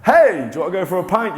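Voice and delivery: high-pitched, eager pub invitation; pushy inner voice. Acted. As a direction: call out brightly like an overfriendly acquaintance. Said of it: "was a physical person and went".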